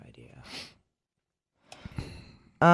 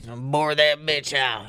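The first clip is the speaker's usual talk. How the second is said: lightly voiced mock borer; put-on company voice. Co-host joins the tunnel company bit, put-on voice. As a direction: join in with a put-on digger voice. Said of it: "You gotta say it in that voice".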